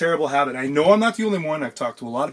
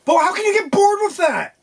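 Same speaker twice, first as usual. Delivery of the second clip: very high, loud squeal of disbelief; incredulous protest in a piercing high voice; outraged disbelief; 6 dB louder than usual. Real, not acted. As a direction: squawk it at the top of your range. Mock outrage at co-host's boredom.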